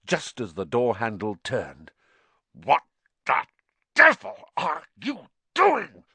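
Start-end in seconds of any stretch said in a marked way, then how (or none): 2.5-6.0 furious, teeth clenched; through gritted teeth. A narrator's reading. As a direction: snarl it, jaw tight. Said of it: "through gritted teeth".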